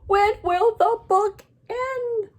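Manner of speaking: high, drawn-out, frantic wail; upset storybook shout; loud desperate demand, stretched out; Gerald. Acted. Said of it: "he's not happy. He's shaking Piggy".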